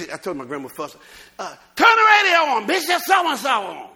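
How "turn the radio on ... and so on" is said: high, loud call across the house; excited shouting. Retold or imitated. Preacher acts out phone call to grandmother, excited shouting. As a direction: shout it out high, stretching the last words. Grandmother told to turn radio on.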